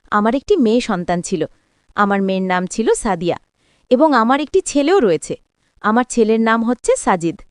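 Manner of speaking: usual speaking voice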